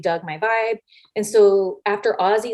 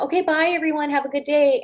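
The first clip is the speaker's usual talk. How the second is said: high, singsong farewell; cheery goodbye; 6 semitones higher than usual. Retold or imitated. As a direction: imitate an overly polite goodbye to the group. Polite goodbye leaving the elevator.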